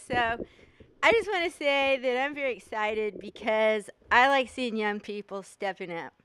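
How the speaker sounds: normal talking voice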